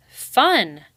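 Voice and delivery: loud scornful bark of a word; snarled. Acted. Rai snarling at his siblings. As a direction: spit the word out in contempt. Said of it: "Rai snarled under his breath".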